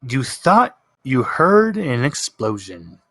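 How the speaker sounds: ordinary talk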